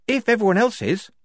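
high, hesitant offer; tentative. A narrator's reading. Shufti giving in before the squad, tentative. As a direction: venture it as a cautious condition. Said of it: "Shufti ventured".

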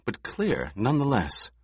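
usual speaking voice

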